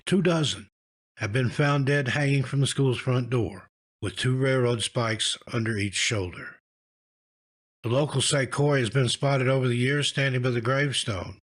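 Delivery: usual speaking voice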